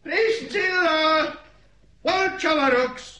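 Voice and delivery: high, drawn-out summoning shout. Acted. Grandfather summons his wandering granddaughter, shouting. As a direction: call out sharply to someone far off. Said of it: "ne kiabálj".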